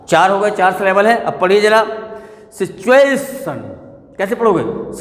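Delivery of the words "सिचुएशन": "सिचुएशन" slow, with a long, strong ए; stress on A. Shown as a demonstration. Teacher demonstrating stress, "सिचुएशन" stressed on ए. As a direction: stretch the ए in "सिचुएशन". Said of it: "ए पर है".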